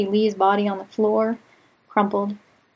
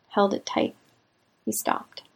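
usual speaking voice

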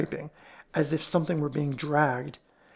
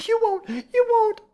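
very high, loud pleading; wailing; 7 dB louder than usual. A narrator's reading. Fat woman left facing the police, wailing. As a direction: plead in a panicky squeal. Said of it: "she wailed".

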